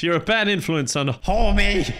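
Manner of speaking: normal talking voice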